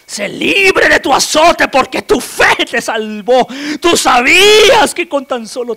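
high, loud, slow proclamation; booming declaration of healing; proclaiming shout. Acted. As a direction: declare her free with full force. Jesus proclaims healing loudly.

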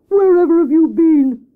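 high, loud exclaimed question; crying out. Acted. Hare greets the returning rabbit, crying out. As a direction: cry it out indignantly. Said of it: "cried Hare".

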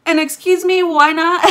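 high, loud, incredulous protest; shocked disbelief. Retold or imitated. Telling the doctor she still needs anesthesia, in loud shocked disbelief.

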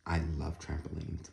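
plain reading voice

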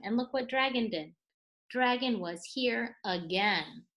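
ordinary delivery, nothing marked

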